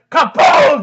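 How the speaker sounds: loud, high mock explosion; shouted impact noise. Man takes up the game and gets hit, shouted sound effect. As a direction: shout it like a comic-book blast. Imitated blow in retold fight.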